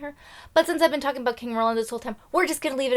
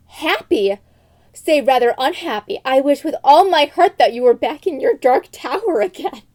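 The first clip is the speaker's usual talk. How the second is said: loud hammed-up retort, raised voice; mock angry outburst; 3 semitones higher, 7 dB louder than usual. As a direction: fling it at him in mock fury. Princess quote, angry outburst.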